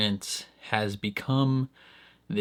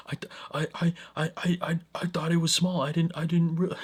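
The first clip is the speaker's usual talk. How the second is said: high, stammering panic; mock panic; 7 semitones higher than usual. Panicking doctor making excuses, high stammering mock panic. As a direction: stumble over every word. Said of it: "And he's panicking".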